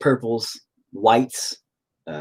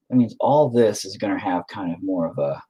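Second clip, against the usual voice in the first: ordinary delivery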